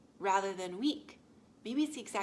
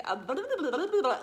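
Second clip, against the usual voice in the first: higher, louder, laughing blah-blah imitation; mock rambling client; 6 semitones higher, 8 dB louder, faster than usual. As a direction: send up a frazzled client's chatter, half laughing. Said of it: "and she was like".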